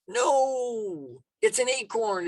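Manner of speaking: high, drawn-out "no" sliding down; emphatic mock dismissal. Speaker answering whether an acorn can do that, emphatically dismissive. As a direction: dismiss the silly idea with an exaggerated high note.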